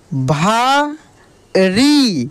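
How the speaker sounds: भा and री held long with a pause between; drawn-out syllables. Shown as a demonstration. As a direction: sound out भा री slowly in two beats. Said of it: "खींच कर किया जाता है". (भा री, खींच कर अलग-अलग)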